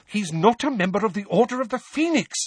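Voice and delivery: high, insistent protest; indignant motherly objection; heated objection. A narrator's reading. Mother interrupts in heated argument.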